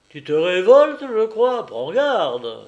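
furious master warning a slave; angry threat. A narrator's reading. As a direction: burst out, then come down on the warning.

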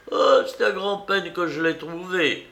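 put-on high-pitched, animated old lady; fussy old aunt. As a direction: exclaim as a flustered elderly aunt.

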